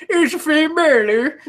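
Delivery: Irish lilt, slow and high; Irish accent. Pretend. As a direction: put on a playful Irish accent. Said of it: "This is my Irish accent impression".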